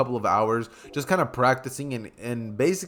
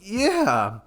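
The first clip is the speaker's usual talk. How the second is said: higher little one-word answer; voiced shy girl; 6 semitones higher than usual. Hinata replying to Naruto's joke, lightly voiced shy girl. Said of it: "Hinata would just be like".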